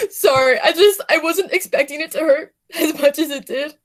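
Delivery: laughing through fake tears; hammed-up hurt feelings; mock crying laugh. Fake hurt after boos.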